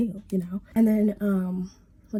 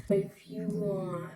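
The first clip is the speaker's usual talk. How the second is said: much softer, drawn-out IF YOU WANT; mocking echo; 8 dB quieter, much slower than usual. Echoing dad's half-hearted offer, mocking. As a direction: stretch out "If you want" slowly and quietly.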